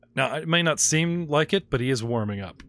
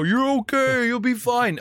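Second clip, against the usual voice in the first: higher, falsely cheerful reassurance; chirpy rescuer, too bright; cheery evac worker; 10 semitones higher, 3 dB louder than usual. Retold or imitated. Evac guys reassuring survivors, falsely cheerful. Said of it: "the evac guys".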